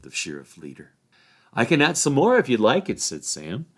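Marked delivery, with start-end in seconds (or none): 1.5-3.0 raised, louder cheeky hobbit; cheeky Sam. A narrator's reading. Sam goading the sheriffs, cheeky and loud. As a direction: goad the officials.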